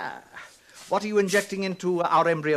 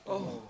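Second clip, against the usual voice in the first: flustered cough; 11 dB quieter than usual. Acted. Director flustered after saying "mother.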